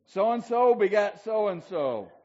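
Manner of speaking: loud, high, slow mock scripture reading; mock Bible recital. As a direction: intone it slowly and loudly like a grand list of names. Mock reading of Bible name lists.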